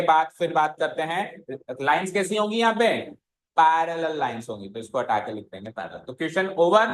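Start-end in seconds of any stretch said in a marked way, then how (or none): none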